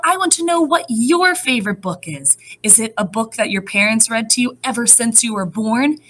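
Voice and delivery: ordinary talk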